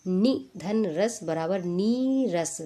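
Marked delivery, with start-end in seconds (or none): 1.6-2.6 नी रस with a drawn-out "nee"; long-vowel demo. Shown as a demonstration. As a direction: stretch नी in नी रस. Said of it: "दीर्घ में बदल दिया जाता है".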